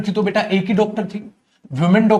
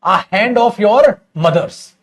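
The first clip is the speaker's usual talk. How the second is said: slow dictation; much slower than usual. Shown as a demonstration. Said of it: "ऐसे भी लिख सकता हूं".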